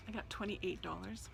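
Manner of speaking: hushed whisper in a store. Real, not acted. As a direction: keep it quiet, barely voiced. Hushed in store, repeated later.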